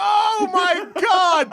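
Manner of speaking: long, high, loud outburst; incredulous shout. Real, not acted. Co-host hearing of the mute button, incredulous shout. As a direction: draw it out in loud disbelief.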